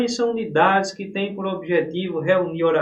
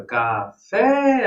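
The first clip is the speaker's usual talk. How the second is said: "café" with FÉ held very long and louder; drawn-out teacher's demo, "ca-FÉÉÉ"; stressed-syllable demo; 5 semitones higher, 6 dB louder than usual. Shown as a demonstration. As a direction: stretch out the last syllable of "café".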